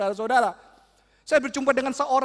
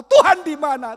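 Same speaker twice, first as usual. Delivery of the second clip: anguished cry; 5 semitones higher, 10 dB louder than usual. Acted. Boy crying out to God.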